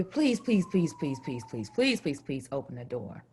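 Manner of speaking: put-on begging boyfriend. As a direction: plead at the door, fading away.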